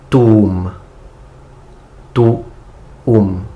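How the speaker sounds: each word read slowly, twice, with long pauses. Shown as a demonstration. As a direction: break the prayer into words for students to repeat. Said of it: "Then I will read it very slowly, breaking each single word".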